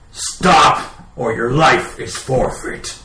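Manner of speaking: loud commanding shout; shouted threat. Acted. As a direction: bellow the threat. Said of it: "scream at them".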